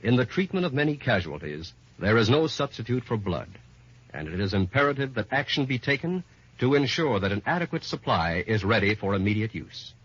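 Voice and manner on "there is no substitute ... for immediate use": normal talking voice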